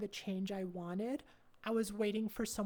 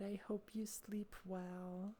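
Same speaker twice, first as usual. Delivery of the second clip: whispering; 8 dB quieter than usual. Real, not acted. Softer whispered repeat.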